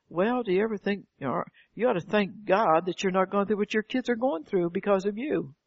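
usual speaking voice

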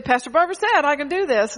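preacher mocking listeners who twist her words, smug; mocking a congregant. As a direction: parody a know-it-all church member. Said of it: "you take what I say".

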